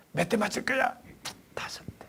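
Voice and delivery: whispered question and tiny answer; hushed dialogue. Retold or imitated. Mother quietly asks the daughter how many strokes, whispering. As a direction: whisper both voices softly.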